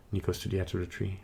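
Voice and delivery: usual speaking voice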